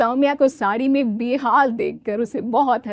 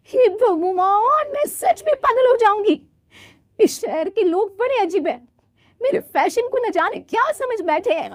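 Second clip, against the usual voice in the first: exasperated bride; 11 semitones higher than usual. Acted. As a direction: wail हे भगवान in frustration. Soumya despairs. (बहुत ऊँची, परेशान आवाज़ में)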